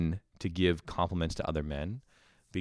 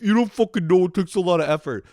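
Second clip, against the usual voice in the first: much higher, louder whiny critic voice; mock critics; 11 semitones higher, 9 dB louder than usual. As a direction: mimic the nagging crowd. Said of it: "a hundred people be like".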